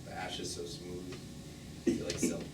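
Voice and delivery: almost to himself, drawn into the memory; hushed confession. Acted. Confessing how he holds the ashes.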